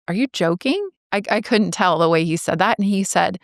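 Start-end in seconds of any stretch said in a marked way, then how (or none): 0.1-0.9 incredulous question, pitch climbing high; disbelieving. Retold or imitated. Daughter asking dad about the will, disbelieving. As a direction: ask it higher, in mild disbelief.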